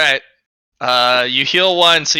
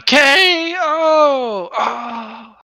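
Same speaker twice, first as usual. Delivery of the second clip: fight announcer; 11 semitones higher, much slower than usual. Acted. GM calls out a knockout.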